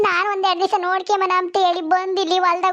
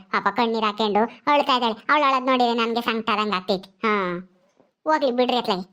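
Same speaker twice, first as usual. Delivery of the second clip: put-on soothing older mother; 7 semitones lower than usual. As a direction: hush her gently like a village mother. Plays the mother figure.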